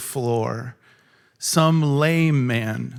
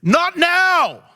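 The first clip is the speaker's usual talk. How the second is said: much higher, louder hammed-up voice brushing him off; mock brush-off as Jesus; 11 semitones higher, 5 dB louder than usual. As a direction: play an annoyed busy Jesus, overdone. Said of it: "And Jesus doesn't say".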